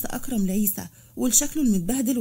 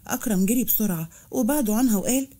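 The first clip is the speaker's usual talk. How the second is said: ordinary delivery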